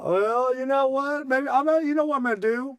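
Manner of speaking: high, revved-up boasting; excited. Real, not acted. Bar owner boasting to co-host about his dance, excited. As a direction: brush off the need to practice.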